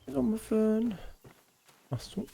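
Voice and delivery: high, soft cooing to a pet; pet-greeting voice. Real, not acted. Greeting Muffin, likely a pet.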